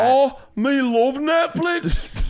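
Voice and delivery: high, slow, hammed-up Scottish woman; squeaky-high botched Scottish accent; failed female Scottish accent. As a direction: badly fake a Scottish chick's accent, way up in pitch. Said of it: "then I'll do female Scottish accent".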